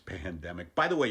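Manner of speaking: ordinary talk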